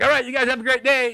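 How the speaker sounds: very high, bright farewell; mock cheery goodbye. Leaving Craig, mocked very high. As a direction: sweeten the goodbye. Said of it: "I'm like".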